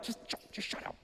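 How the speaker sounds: whispered shush; hushing whisper. Acted. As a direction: hiss at him to be quiet. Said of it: "people are like".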